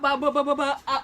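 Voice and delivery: stammering, laughing through the burn; mouth burning from spicy food. Real, not acted. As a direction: sputter as the spice hits. Mouth burning, can't talk.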